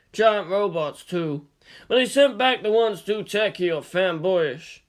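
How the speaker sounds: lightly voiced pudgy old man, chatty; a hint of a gruff shopkeeper gossiping; old shop owner. A narrator's reading. Shop owner tells Makoto about his sold stock, lightly voiced.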